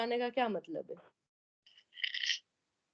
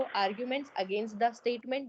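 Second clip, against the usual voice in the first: "Arguments against the statement" said very slowly, word by word; slow dictation; much slower than usual. Shown as a demonstration. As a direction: dictate it slowly so the class can copy. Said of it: "इससे better है आप क्या लिखो".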